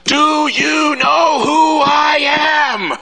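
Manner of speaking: slow, very high, loud shout; raging shout. Retold or imitated. Man leaning over the counter at the agent, raging. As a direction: scream it at full fury. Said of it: "blood vessels popping in his forehead".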